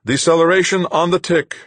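high, loud ringing call-out. A narrator's reading. Duchamp calling deceleration to the crew, ringing out. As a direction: let it ring out across the ship. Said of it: "Duchamp's voice rang out".

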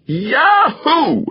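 high, loud whoop of joy; heartfelt shout. A narrator's reading. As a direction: let it burst out at the top of the voice. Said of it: "shouted a heartfelt".

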